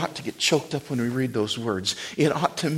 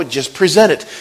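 ordinary delivery

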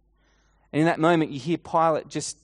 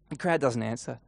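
ordinary delivery, nothing marked

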